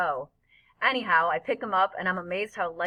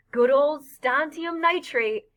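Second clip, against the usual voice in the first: high, loud, drawn-out call; excited shouting; 7 semitones higher, 5 dB louder, slower than usual. A narrator's reading. Freak shouting as the blossoms flash blue, excited shouting. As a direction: hail it like an old favorite. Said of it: "Freak keeps on shouting out the names".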